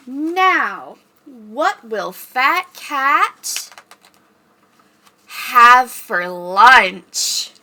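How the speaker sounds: very slow drawn-out question; suspenseful slow. Pretend. Librarian building suspense for kids, very slow. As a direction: stretch it for suspense.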